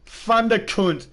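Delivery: loud, slow and high, relishing the name "Thunderkunt"; gleeful shout. Real, not acted.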